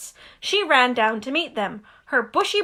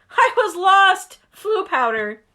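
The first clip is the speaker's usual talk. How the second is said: higher, louder, slow relieved outburst; flustered explaining; 7 semitones higher, 5 dB louder, slower than usual. Acted. Harry telling Hagrid he got lost, flustered. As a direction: blurt it out in relief.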